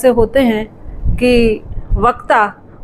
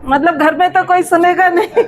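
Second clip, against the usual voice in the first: laughing; 5 semitones higher than usual. Real, not acted. Self-mocking joke about home.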